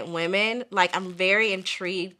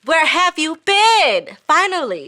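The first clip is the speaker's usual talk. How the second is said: excited greeting; 8 semitones higher, 7 dB louder than usual. Real, not acted. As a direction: call out delighted to see her.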